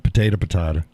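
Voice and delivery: "potato" first as po-TAY-to, then po-TAH-to; contrasting pronunciations. Shown as a demonstration.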